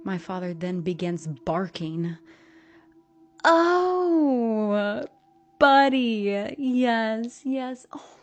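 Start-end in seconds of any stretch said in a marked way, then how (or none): 3.4-8.2 high, loud, hammed-up sympathy; overplayed mock grief; fake-sorry mother. A narrator's reading. Mother fakes sorrow about dog.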